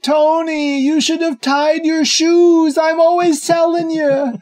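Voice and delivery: high, loud, drawn-out scolding; mock scolding yell. Joya scolds dead Tony for untied shoes, hammed-up yelling. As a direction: holler it high and slow, hammed-up nagging.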